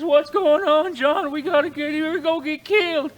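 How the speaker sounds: high, loud panicked yelp; panicked cry. Acted. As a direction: shriek it in a sudden panic. Potts wakes in panic.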